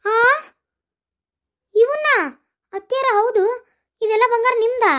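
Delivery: high-pitched astonished questioning; amazed. Acted. Daughter-in-law asking if all the gold is hers, amazed. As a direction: ask in disbelief, pitched right up.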